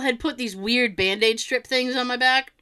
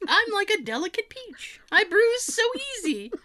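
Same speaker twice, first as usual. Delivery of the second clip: high, dainty mock-fragile voice; mock delicate; 12 semitones higher than usual. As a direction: play the delicate little peach. Mock delicate self-pity.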